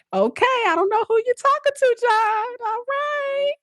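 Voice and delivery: high, put-on sassy voice, brushing him off; petty dismissive. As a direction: play dumb, talk right past him. Acting petty dismissal of John.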